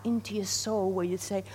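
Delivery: ordinary talk, nothing marked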